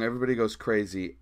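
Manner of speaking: ordinary talk, nothing marked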